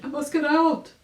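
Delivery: high, loud panicked wail; frightened cry. A narrator's reading. Rabbit sinking in the fountain, high frightened loud cry. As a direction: cry out in terror of drowning. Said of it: "he cried".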